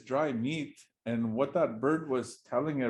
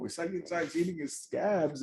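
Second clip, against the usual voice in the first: lightly higher bird voice, a bit softer; bird telling everyone; 5 semitones higher, 3 dB quieter than usual. Acted. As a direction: tattle it like a gossiping bird. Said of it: "that bird is going around and telling".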